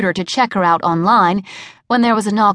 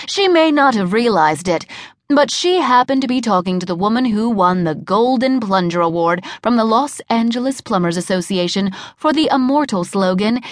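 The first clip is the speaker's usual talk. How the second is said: usual speaking voice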